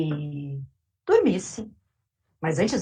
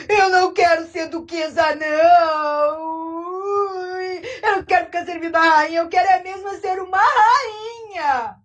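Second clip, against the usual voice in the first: put-on much higher, loud, tearful whining old woman; crying old lady; 8 semitones higher, 4 dB louder than usual. Old lady wailing in her empty house, high and tearful. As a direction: whimper and wail like a spoiled old lady. Duchess demands to be queen.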